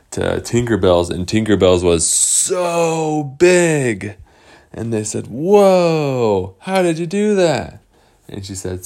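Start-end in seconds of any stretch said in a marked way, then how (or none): 2.1-4.2 "so" and "big" each stretched long and high; drawn-out emphasis. A narrator's reading. Storyteller showing how huge Tinkerbell's was, drawn-out emphasis. Marvels at snowman's size. 5.3-7.8 high, drawn-out "whoa" of awe; amazed. Acted. Boys seeing Tinkerbell's huge build, amazed.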